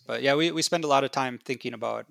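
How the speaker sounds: normal talking voice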